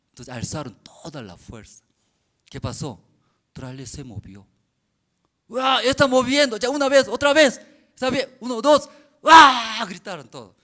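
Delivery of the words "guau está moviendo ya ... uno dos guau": high, loud excited shout; excited cheer. Retold or imitated. Workers cheer loudly as the trailer moves.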